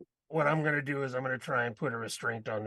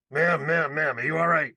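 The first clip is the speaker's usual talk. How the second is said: mildly urgent, louder check-in; calling out concerned; 3 semitones higher, 6 dB louder than usual. Acted. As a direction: get a stranger's attention, a bit louder. Player approaches distressed woman.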